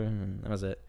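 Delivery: normal talking voice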